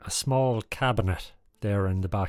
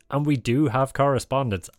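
ordinary delivery

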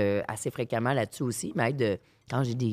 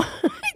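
short laugh. Real, not acted. Laughing while telling anecdote.

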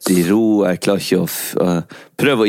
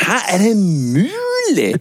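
surprised; 8 semitones higher than usual. Pretend. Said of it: "Jeg bare".

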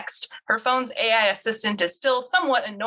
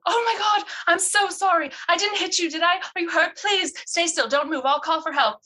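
much higher, alarmed voice; panicked apology; 9 semitones higher than usual. Acted. Bess to the woman she nearly ran over, panicked and apologetic. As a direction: fuss over them in a fright.

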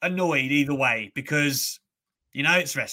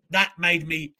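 ordinary talk

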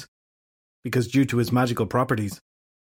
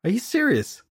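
pitched-up, sharply annoyed question; rising anger; 12 semitones higher than usual. Acted. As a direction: let irritation climb through it. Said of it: "anger rising in her voice".

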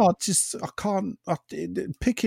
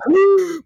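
a big high gasp, sucking air in; sharp inhaled gasp; 7 dB louder than usual. Shown as a demonstration. Demonstrating the Yorkshire breathing-in trick with a gasp. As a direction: gasp in hard as a Yorkshire accent joke. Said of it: "Breathe in like".

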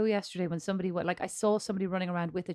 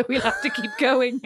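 laughing; 7 semitones higher, 10 dB louder than usual. Real, not acted. Laughs at own teasing.

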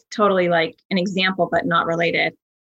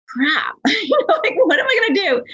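retold panic; 11 semitones higher than usual. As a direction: replay the moment of dread, pitched up. Retold panic in the middle of lake.

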